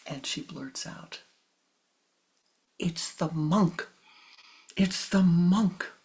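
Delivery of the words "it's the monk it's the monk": slow, rising, insistent cry; a pleading blurt naming the monk; blurted accusation. Acted.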